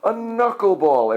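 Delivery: high, astonished echo; the whole class reacting amazed; amazed exclaim. A narrator's reading. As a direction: repeat it in wonder. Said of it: "everyone exclaimed".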